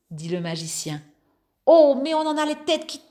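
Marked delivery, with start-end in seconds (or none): 1.7-3.0 fast, high dizzy exclamation; excited cry. Acted. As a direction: cry out, head spinning. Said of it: "crièrent les voisins".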